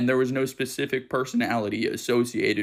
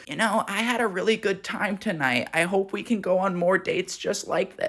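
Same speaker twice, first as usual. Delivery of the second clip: woman on a date; 5 semitones higher than usual. Retold or imitated. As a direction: voice a cougar leaning in to her date. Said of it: "I see this woman lean closer".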